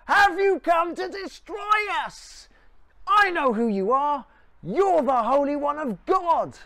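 loud, high-pitched sudden shout; shouting outburst. Acted. Possessed man interrupts Jesus in the synagogue, shouting. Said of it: "someone stands up and shouts".

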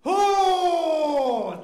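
long, high, loud "oh"; imitated wail of a stronger contraction; hammed-up bellow, held long; Welsh labour bellow. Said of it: "the kind of noise that comes out of a Welsh lady".

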